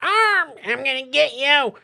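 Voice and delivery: high, taunting rat-monster voice; rat villain voice. Acted. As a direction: squeak out a gloating threat. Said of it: "He's like".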